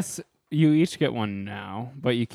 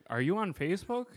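mock eager asker; 7 semitones higher, 5 dB quieter than usual. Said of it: "people are so like".

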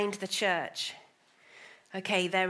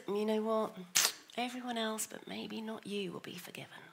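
voice of shame; 9 dB quieter than usual. Acted. Preacher voicing the shame that drags us down, fading to a whisper. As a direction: let the shame's voice sink into a whisper.

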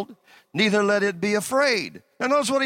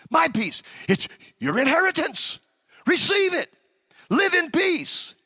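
full-force preaching; 6 semitones higher than usual. Acted. Preacher pressing the congregation to take the gift of peace, full-force preaching. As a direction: preach it with rising fire.

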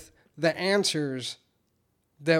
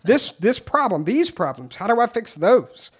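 angry; 7 semitones higher, 8 dB louder than usual. Retold or imitated. Angry rant at sponsor.

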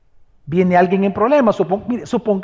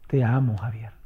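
tender; 9 semitones lower, 6 dB quieter than usual. Retold or imitated. Mother's tender words, soft.

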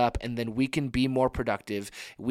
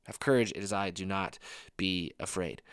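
softer, slowing repeat of the promise; a quiet, tender echo of Jesus' words; soft reassurance; 4 semitones lower, 6 dB quieter than usual. Shown as a demonstration. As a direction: let it sink in gently. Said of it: "repeats the line softly".

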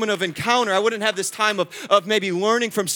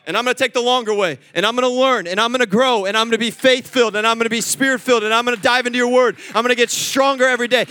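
fervent vow; 2 semitones higher, 6 dB louder than usual. Acted. Preaching climax, vowed prayer.

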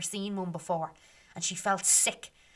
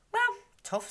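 mocking dismissal. Fairies dismiss Meg.

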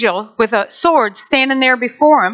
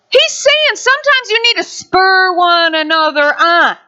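usual speaking voice

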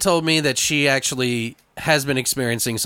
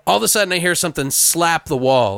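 ordinary talk, nothing marked

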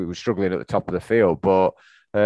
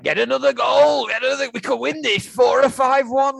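excited shout. Retold or imitated. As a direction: shout it greedily, desperate for goals. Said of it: "I was like".

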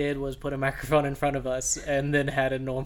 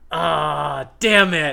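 frustrated exclamation; 9 dB louder, much slower than usual. Real, not acted. Missed the obvious rule.

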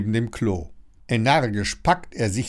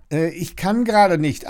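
higher, hedging reply; hesitant excuse; 7 semitones higher, 4 dB louder than usual. A narrator's reading. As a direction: stall with an awkward excuse. Lilly stalls the neighbour at door.

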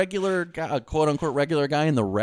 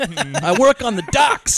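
slow, louder hammed-up everyman; mock blue-collar voice; 5 semitones higher, 4 dB louder, much slower than usual. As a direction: drag it out like a fake tough guy. Mocking Cruise as dock worker.

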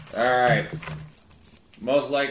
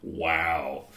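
very low, soft "wow"; low rumble of amazement; deep voice; 12 semitones lower, 8 dB quieter than usual. Real, not acted. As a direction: drop way down into a deep voice. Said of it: "That was a deep voice you just did".

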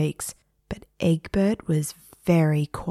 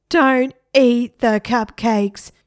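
high, loud, slow scream; teacher screaming; 6 semitones higher, 6 dB louder, slower than usual. A narrator's reading. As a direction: scream the warning to the class. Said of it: "The teacher screamed".

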